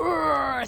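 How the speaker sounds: playful roaring noise; imitated roar. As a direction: imitate his big roar. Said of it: "he does this whole".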